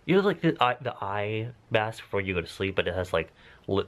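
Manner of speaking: ordinary talk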